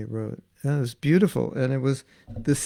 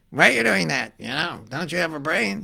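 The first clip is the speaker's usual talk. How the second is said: complainer; 3 semitones higher than usual. Retold or imitated.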